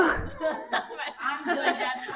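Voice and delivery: short laugh breaking off the lyric; embarrassed laugh. Real, not acted.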